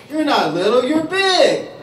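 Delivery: a hint of a taunting kid, rising high on big; teasing schoolyard jab, squeaking up at the end; lightly mocking playground voice. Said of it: "They come up and they say".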